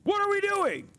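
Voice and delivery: high strained What are we doing; incredulous. Real, not acted. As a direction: pitch it way up, stunned. Exasperated rant.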